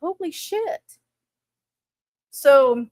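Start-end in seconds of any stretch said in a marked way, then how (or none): 0.0-1.0 higher, softer stunned curse; shocked aside. Retold or imitated. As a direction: gasp it out under your breath. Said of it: "And I'm like".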